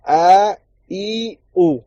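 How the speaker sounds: اے ای او sung out slowly as three vowels; vowel demo. Shown as a demonstration. Teacher spelling out the vowels, slow. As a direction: stretch each vowel slowly. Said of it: "اے اے او نہیں ہے".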